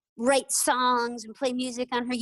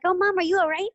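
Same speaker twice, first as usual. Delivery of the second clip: put-on higher, sweet little girl checking on mom; concerned child; 7 semitones higher, 4 dB louder, faster than usual. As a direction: pitch up, a kid's worried question. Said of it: "she was kinda like".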